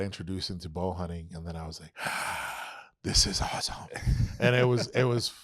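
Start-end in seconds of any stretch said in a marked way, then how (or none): 2.0-2.8 mock gasp. Amazed at first bow shot. 3.0-3.7 whispered in hushed wonder; a hushed, amazed whisper; awed whisper. Retold or imitated. Retold awe at first shot.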